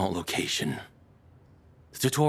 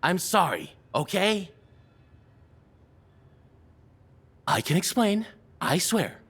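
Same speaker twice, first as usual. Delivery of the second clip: flustered pleading; 10 semitones higher than usual. Acted. Captor flustered, placating captive.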